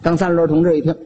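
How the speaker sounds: ordinary delivery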